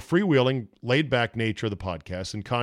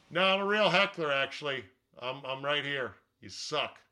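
heckler voice; 2 semitones higher, 7 dB quieter than usual. Pretend. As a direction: heckle him like a rowdy fan. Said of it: "your heckler voice".

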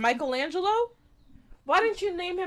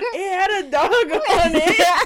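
slow, loud, laughing quote; 5 semitones higher, 10 dB louder than usual. Retold or imitated. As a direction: say it through laughter. Dad's excuse quoted, laughing.

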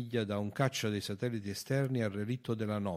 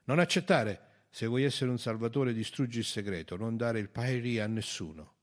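curt urging; 3 semitones higher, 7 dB louder than usual. A narrator's reading. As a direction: urge firmly against accepting. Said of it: "intervenne seccamente". (monito secco e deciso)

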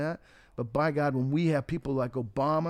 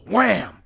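a loud sound effect for the ambulance hitting him; loud wham; 7 semitones higher, 10 dB louder than usual. Acted. The teller yells the crash as the ambulance hits. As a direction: shout the crash as a sudden, sharp impact.

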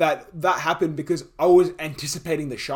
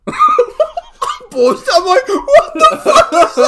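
very high, slow, laughing squeal; squealing through laughter; laughing shriek; 12 dB louder, much slower than usual. Retold or imitated. Laughing while retelling his childhood fright, in a high squeal. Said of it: "retelling terrified child, laughing".

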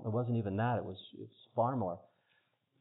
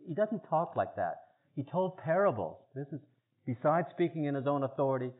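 usual speaking voice

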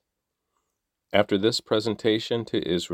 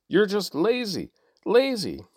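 shout; 13 semitones higher than usual. Acted. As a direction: yell the insult at the foremen. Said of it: "But Pharaoh shouted".

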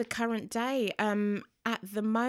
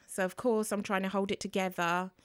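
ordinary talk, nothing marked

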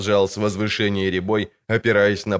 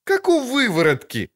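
put-on much higher, young girl's pert voice; a sharp, high girlish retort. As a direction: contradict him like a cheeky girl. Ciri voice.

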